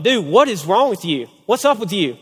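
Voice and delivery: mock bafflement. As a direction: playfully demand an explanation. Retells his baffled question to teen.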